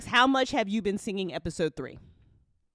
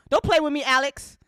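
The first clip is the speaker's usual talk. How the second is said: loud, higher playful scold; mock scolding; 10 semitones higher, 11 dB louder than usual. Host mock-scolding Alex about the episode number, loud. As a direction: pretend to be cross, laughing.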